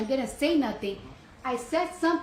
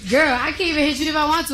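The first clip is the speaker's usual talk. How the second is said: fast, higher sassy comeback; sassy retort; 4 semitones higher, 5 dB louder, faster than usual. Retold or imitated. To the pregnant guard, fast sassy comeback. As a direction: rattle it off with attitude.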